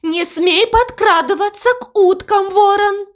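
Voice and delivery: high-pitched warning cry; cow's high, urgent cry; calling out. A narrator's reading. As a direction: call after the raven to stop him. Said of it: "Мама Му крикнула ему".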